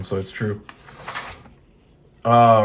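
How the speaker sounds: ordinary delivery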